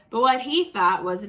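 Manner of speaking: usual speaking voice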